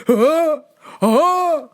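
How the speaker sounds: a dad shocked at the mileage, loud and high; dad's loud huh. Retold or imitated. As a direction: bark out the dad's disbelief. Dad quizzing her on miles.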